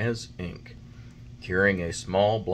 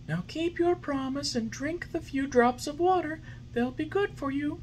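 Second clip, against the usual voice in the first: high, gentle fairy voice; fairy, patient. A narrator's reading. Fairy patiently coaxing the puppet. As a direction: soothe him kindly.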